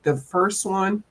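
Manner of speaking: usual speaking voice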